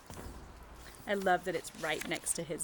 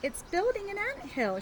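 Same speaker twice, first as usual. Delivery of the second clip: put-on bright, much higher little-girl voice for Callie; Callie's voice; 11 semitones higher than usual. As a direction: lift it high like an excited child. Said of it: "she says".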